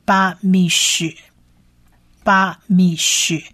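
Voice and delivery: 巴米什 in separated syllables, ending on a SH sound; syllable-by-syllable model. Shown as a demonstration. Teacher building the word so far, 巴米什 syllable by syllable. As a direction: model 巴米什 for repetition, each syllable apart. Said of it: "所以就变成巴米什".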